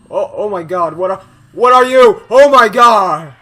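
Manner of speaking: very loud, high-pitched shriek of fright; real scared yelling. Real, not acted. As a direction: yell out, startled by a monster. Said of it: "I was actually very scared by that".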